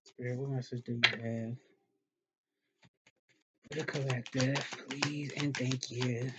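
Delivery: rough, just-woke-up voice; husky and worn, half asleep; raggedy voice. Real, not acted. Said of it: "Voice all raggedy right now".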